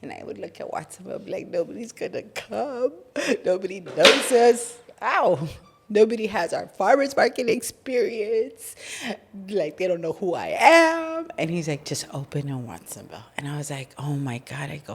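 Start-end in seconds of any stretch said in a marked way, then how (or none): 1.5-11.3 higher, near-tearful whining; whiny despair. Retold or imitated. Wife fretting to cousin about a new store: higher, teary whine. Complaining about opening in Watsonville. 11.8-13.2 soft, lower urging whisper. Retold or imitated. Cousin quietly urging Nancy to open the store: whisper. 14.0-14.7 hushed, overwhelmed oh my God; whisper. Retold or imitated. Nancy overwhelmed by her cousin's push: whispered disbelief.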